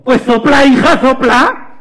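hammed-up mother telling off her child; exasperated mother. Mother telling daughter to blow, exasperated loud order. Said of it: "lo que dicen todas las madres".

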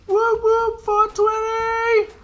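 high, whooping party cheer; high-pitched hooray; mock celebration. Mock celebration of the late hour.

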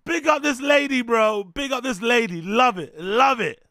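loud hype. Real, not acted.

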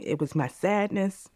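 ordinary talk, nothing marked

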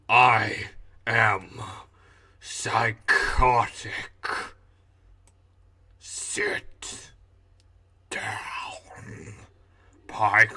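drawn-out psycho villain voice; slow psycho, dropping into whispered threat; Hugo. Acted. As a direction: menace each word slowly, long pauses between. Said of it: "the psycho".

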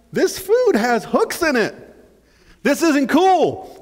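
high, slow dismay as the hook bites; alarmed realization. Acted. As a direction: drag it out in growing alarm. Fish discovers the hook.

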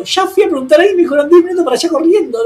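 very high, frantic retold protest; protesta desesperada imitada. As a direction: protest in a high, breathless-pace rush. Acted frantic protest in retold quarrel. (protesta aguda y atropellada, actuada)

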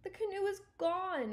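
high-pitched acted groan; groaned dismay. Stuart finding his canoe gone, groaning in dismay. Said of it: "he groaned".

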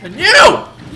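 loud, high angry shout; real angry yelling. Real, not acted. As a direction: yell out frustration. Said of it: "I'm angry!".

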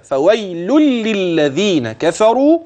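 slow high chanted verse; measured tajweed chant; recitation. Shown as a demonstration. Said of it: "خلي بالك".

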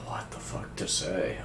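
ordinary delivery

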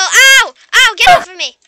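loud frantic yelling; panicked shout. Real, not acted. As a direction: yell at the dragon in pain. Dragon hitting her.

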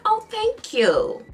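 high, slow mock clerk thanks; a lightly voiced pleased clerk; clerk's thanks. The clerk thanking her for the compliment, high and slow. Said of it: "She's like".

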